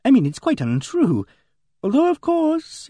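backtrack in confusion, pitch climbing; Emily Barton, flustered. A narrator's reading. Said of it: "Emily Barton was pink and confused".

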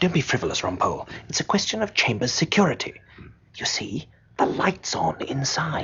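hushed, urgent reproof turning to whisper; conspiratorial whisper. Acted. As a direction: keep it under your breath, secretive. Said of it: "why are we whispering?".

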